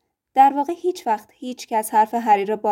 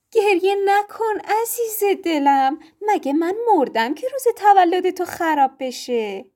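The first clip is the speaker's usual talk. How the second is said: high, slow cooing mother voice; comforting mother; 8 semitones higher than usual. A narrator's reading. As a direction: coo over a spoiled child. Mother comforts crying son.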